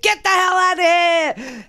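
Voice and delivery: high shouted mock outrage; wife's yell. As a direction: yell him out of the room like the angry wife. Wife yelling at the doctor.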